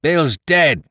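high, loud call of alarm; shouting from the house. A narrator's reading. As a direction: shout the news to those outside. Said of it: "a voice shouting from the house".

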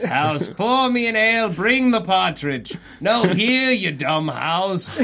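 high, hammed-up order to the house; playing a pompous master at his smart house; mock lordly command. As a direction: boss the house like a grumpy lord. Bossing a smart house, pretend.

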